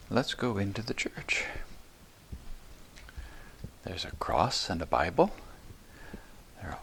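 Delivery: soft, voiceless hush; hushed whisper. Real, not acted. Leading children into the quiet church, whispering. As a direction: whisper it as you enter the church.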